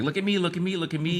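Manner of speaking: high, sing-song mock plea; a light teasing imitation of a show-off; mock attention-seeking. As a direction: lightly parody someone begging for attention. Mocking attention-seeking creators.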